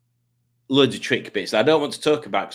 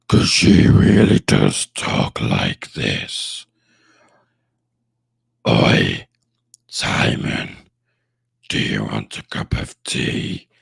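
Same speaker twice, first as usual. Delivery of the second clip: very low, loud, slow put-on woman's voice; Julie impression; 7 dB louder, slower than usual. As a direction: boom it out deep like Julie. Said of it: "That's what Julie sounds like".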